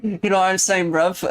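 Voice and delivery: put-on British slang, amused; mock British accent. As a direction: say it British, cracking up. British bit, breaking into laughter.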